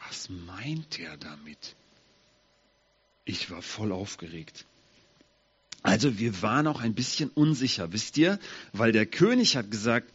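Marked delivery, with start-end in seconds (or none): 0.1-4.6 much softer, slower, lower worry; a quiet anxious aside; nervous hush. Acted. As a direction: hush as if afraid to ask. Mephibosheth nervous, unsure.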